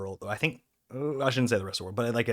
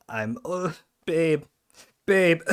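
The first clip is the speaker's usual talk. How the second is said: mock helpless groan rising into louder, higher calls of babe; whiny man cold; 7 semitones higher, 3 dB louder, slower than usual. Sick man calling his partner for help, hammed-up whine. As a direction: play up being pitifully sick, calling for a partner. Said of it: "like the man cold of".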